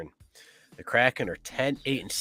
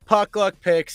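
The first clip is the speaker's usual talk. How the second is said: high, loud announcer read of the title; host announcing; 8 semitones higher, 6 dB louder, slower than usual. Acted. Host resetting after a flub, announcer voice. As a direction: announce the show segment with a punch.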